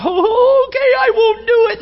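high, loud, tearful mock sobbing voice; hammed-up weeping plea; crying. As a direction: blubber it out like a man in tears. Mock-crying voice in retold scene.

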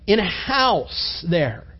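usual speaking voice

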